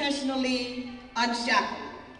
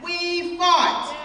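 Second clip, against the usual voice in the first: raised, ringing declaration, each one set apart; defiant refrain; 5 semitones higher, much slower than usual. Acted. Speaker claims the women's resistance for all of them, defiant declaration. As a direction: proclaim it high like a rallying cry.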